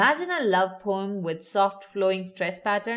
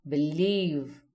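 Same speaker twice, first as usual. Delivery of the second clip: "believe" with "lieve" louder and longer; stress on second syllable; 5 semitones lower than usual. Shown as a demonstration. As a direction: draw out the end of "believe". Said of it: "leave is louder and longer".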